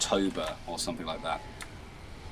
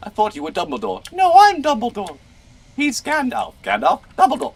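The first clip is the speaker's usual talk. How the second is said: high squeaky banter; 6 dB louder than usual. Pretend. Silly wizard argument voices.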